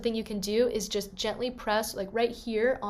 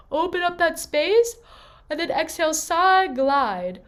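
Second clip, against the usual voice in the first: high yawning sigh gliding down; sighing slide; 7 semitones higher, 7 dB louder than usual. Shown as a demonstration. Coach leading students in a sighing slide, high sigh gliding down. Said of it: "Let's do it together".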